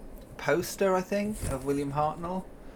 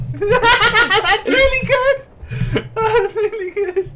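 helpless high, loud laughter through slow words; laughing hard; 12 dB louder, much slower than usual. Real, not acted. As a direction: crack up while praising the impression. Cracking up at the impression.

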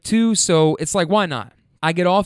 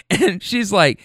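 ordinary talk

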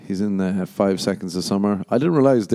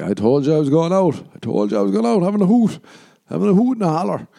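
put-on drunk protest; 9 semitones higher, 3 dB louder, faster than usual. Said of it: "And I was like".

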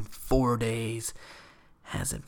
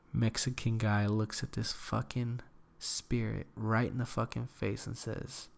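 ordinary talk, nothing marked

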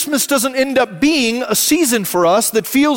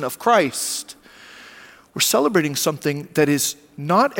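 softer, lower pastoral voice; hushed reflective sermon; 5 semitones lower, 6 dB quieter than usual. Acted. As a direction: draw the congregation into quiet reflection. Quiet reflective preaching.

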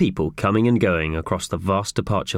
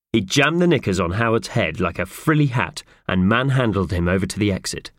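usual speaking voice

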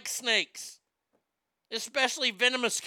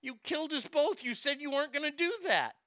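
higher-pitched, lightly voiced frog in mock shock; the frog cries out, stung and betrayed; 8 semitones higher than usual. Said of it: "The frog looks back and goes".

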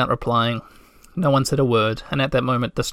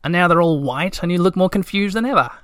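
higher, climbing curious lilt; interested; 5 semitones higher than usual. A narrator's reading. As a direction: let curiosity lift the pitch to the end. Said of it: "said Little My interestedly".

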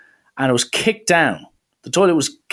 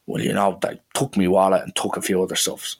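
lightly voiced taxi man, low and softer; rough taxi man; 6 semitones lower, 5 dB quieter than usual. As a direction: play the tough cabbie owning up in a low voice. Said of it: "one of these kinda rough".

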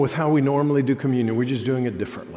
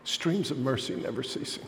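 much softer, near a murmur; hushed reverent line; 10 dB quieter than usual. Acted. Pastor softly echoing the hymn line to the congregation. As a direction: let the words fall quietly, like a prayer.

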